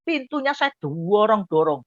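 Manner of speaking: normal talking voice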